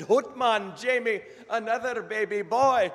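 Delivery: excited Scottish doctor, very high and loud; Scottish brogue. Acted. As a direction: proclaim it in a hammed-up Scottish brogue. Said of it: "best Scottish brogue I can come up with".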